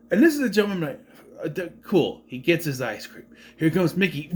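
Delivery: ordinary talk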